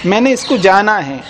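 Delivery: ordinary talk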